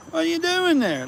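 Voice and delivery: high sing-song voice to the cats; cat-calling voice. Real, not acted. Man calling to stray cats in a high voice.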